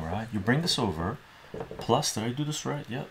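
usual speaking voice

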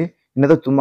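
normal talking voice

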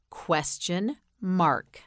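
"Question mark" slowly, with a gap between the words; slow model word. Shown as a demonstration. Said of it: "Say question mark".

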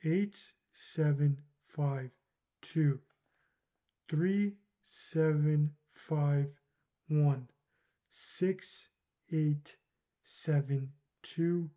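slow, drawn-out digits while writing; slow reading aloud. Real, not acted. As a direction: read each digit slowly as you write.

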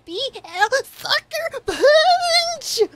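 high, very slow gleeful shout; drawn-out exclamation. Real, not acted. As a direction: stretch it out with glee. Gleeful catchphrase, repeated.

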